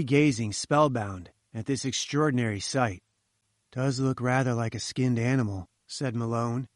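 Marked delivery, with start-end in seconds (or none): none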